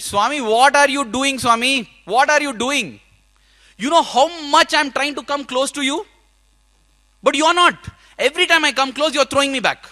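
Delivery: angry outburst. Retold or imitated. Shouting angrily at Swami's picture in the empty room. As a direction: lash out loudly at a picture of God. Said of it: "I was very angry".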